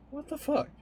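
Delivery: high squeaky what the fuck, pitch shooting way up; shocked disbelief. Retold or imitated. Recalling learning they were dating, high shocked disbelief. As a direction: retell the moment you heard that news, incredulous. Retelling shock at being told.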